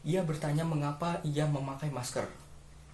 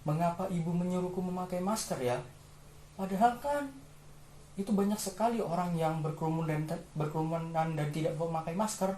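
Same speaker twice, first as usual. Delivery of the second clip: put-on higher-pitched child musing to itself; wondering child; 3 semitones higher than usual. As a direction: play a puzzled child, pitched up. Voices the wondering child of the story.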